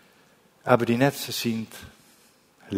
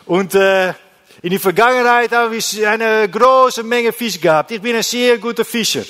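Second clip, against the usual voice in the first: bragging fisherman; 9 semitones higher, 8 dB louder than usual. Retold or imitated. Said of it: "das ist zu anderen Männern".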